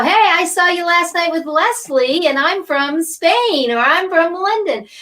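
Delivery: playful high-pitched pretend greeting; eager mock greeting. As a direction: call out like a fan saying hello. Host voices viewers greeting her.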